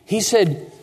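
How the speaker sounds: normal talking voice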